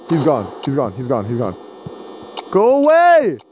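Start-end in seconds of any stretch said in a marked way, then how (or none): 2.5-3.4 yelled high and loud at the monster; angry shout. Real, not acted. Player yelling at the animatronic at the door, furious shout. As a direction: scream it away in frustration.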